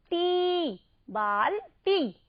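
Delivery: टी held alone, then बाल्टी slowly; long ī sound. Shown as a demonstration. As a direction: model the long टी in बाल्टी for pupils. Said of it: "ऐसे ही बोलिएगा".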